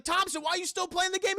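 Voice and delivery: high, exasperated mock question; alarmed demand. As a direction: call out high in disbelief. Friends shouting at Thompson.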